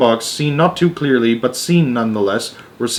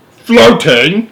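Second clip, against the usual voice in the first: high, loud rising disbelief; incredulous; 9 semitones higher, 8 dB louder than usual. A narrator's reading. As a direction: question it in disbelief. President incredulous.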